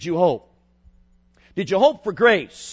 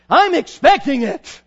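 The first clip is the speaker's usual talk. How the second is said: high, loud and slow burst; expectant cry; 10 semitones higher, 9 dB louder, slower than usual. Acted. Praying teen declaring trust to God, loud and slow. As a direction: cry it out with certainty.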